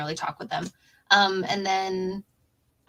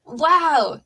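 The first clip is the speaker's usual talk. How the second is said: amazed wow; 8 semitones higher, 8 dB louder than usual. Real, not acted. Reacting to the story.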